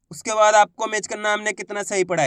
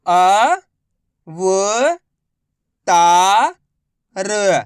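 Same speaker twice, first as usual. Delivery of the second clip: slow, each letter spaced out; letters sounded one by one; much slower than usual. Shown as a demonstration. Teacher showing how to read letter by letter. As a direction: spell it out for the class. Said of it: "चलिए देखिए".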